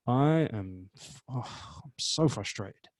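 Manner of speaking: slow, exasperated sighing frustration; frustrated. Shown as a demonstration. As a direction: let the frustration drag it down. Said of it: "which one is more believable".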